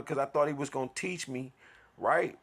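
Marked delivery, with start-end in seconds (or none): none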